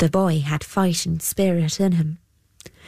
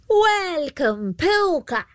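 put-on much higher old banshee hag; banshee calling out; 10 semitones higher, slower than usual. A narrator's reading. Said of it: "she called out".

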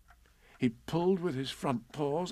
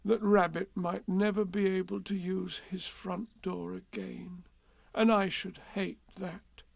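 high, tearful little voice; woeful bear, pitched up; slightly sniffing; 7 semitones higher, 3 dB quieter than usual. A narrator's reading. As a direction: say it as if holding back sniffles. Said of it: "sniffing slightly".

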